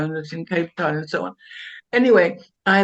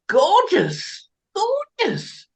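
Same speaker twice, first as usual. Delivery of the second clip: gorgeous, cried out much higher; emotional gorgeous; 11 semitones higher, much slower than usual. Real, not acted. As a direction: swoop gorgeous up high with feeling.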